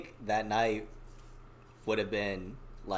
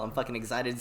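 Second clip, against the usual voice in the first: ordinary talk, nothing marked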